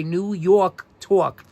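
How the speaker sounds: "New York Talk" slowly in a New York accent, "Yawk Tawk"; drawn-out New York "Tawk". Shown as a demonstration. Teacher to class, demonstrating the New York accent. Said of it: "T A W K".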